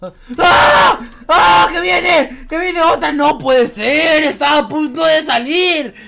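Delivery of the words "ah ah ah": startled screams. Pretend. Scream on seeing someone come.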